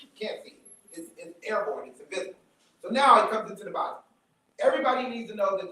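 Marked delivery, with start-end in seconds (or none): 0.0-0.6 hushed whisper. Real, not acted. Invisible disease, hushed aside. 1.9-2.4 hushed whisper. Real, not acted. Invisible disease, hushed aside.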